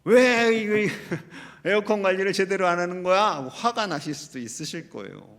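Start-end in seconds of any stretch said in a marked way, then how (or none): 0.0-3.4 loud mock complaint breaking on a laugh; mock angry outburst. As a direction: act out an annoyed gripe. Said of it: "다음 단계 화가 나고".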